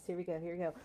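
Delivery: ordinary delivery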